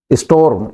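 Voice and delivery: "storm" with the r after the o; correct pronunciation. Shown as a demonstration. Teacher modelling English for students, "storm" pronounced correctly as is-TORM. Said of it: "Storm नहीं, storm".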